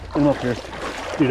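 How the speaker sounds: normal talking voice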